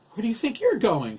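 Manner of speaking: high, loud question; urgent, high-pitched scolding; alarmed mother's demand. A narrator's reading. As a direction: stop your son in his tracks. Alarmed mother grabs son's arm.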